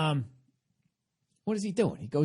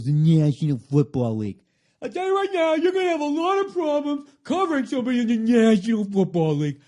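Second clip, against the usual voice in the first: big, booming sports-radio announcer, much higher and louder; Mike and Mike announcer impression; 6 semitones higher, 7 dB louder than usual. Comic mocking a sports-talk host, booming announcer voice. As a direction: puff up like an overblown ESPN host. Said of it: "that Mike and Mike guy always goes".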